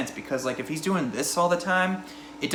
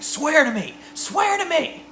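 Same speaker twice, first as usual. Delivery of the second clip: high-pitched mock demand, climbing higher; unintimidating interrogator; 3 dB louder, slower than usual. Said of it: "how intimidating would he be".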